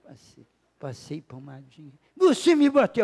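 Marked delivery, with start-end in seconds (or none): 2.1-3.0 put-on high, loud wail of a hurt child; imitated indignant child, high and loud; upset child's accusation. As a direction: cry it out loudly like a wronged little boy.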